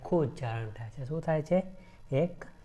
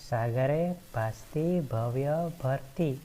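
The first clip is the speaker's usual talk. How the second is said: slow recitation; slower than usual. Shown as a demonstration. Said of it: "તો અહીંયા કયો વર્ણ છે?".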